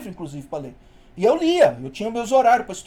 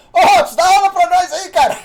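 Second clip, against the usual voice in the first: loud, higher joking shout; teasing call-out; 8 semitones higher, 9 dB louder than usual. Retold or imitated. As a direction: call across the classroom to tease him. Classmates calling out, teasing.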